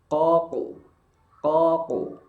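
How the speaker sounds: "Qaku" with the jaw still on the first syllable, thick qaf; qaf sukun drill. Shown as a demonstration. Teacher drills qaf sukun with a still jaw. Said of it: "rahangnya posisi diam".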